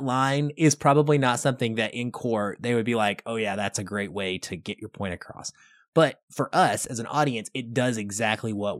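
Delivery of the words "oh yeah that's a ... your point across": mock approval. Imagined court reaction.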